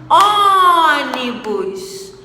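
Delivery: "ônibus" with a loud, high, greatly stretched Ô; exaggerated first-syllable stress. Shown as a demonstration. Teacher showing the antepenultimate is strong, "ônibus" with long loud Ô. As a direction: hold and swell the ô in "ônibus". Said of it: "O on, que é a sílaba tônica".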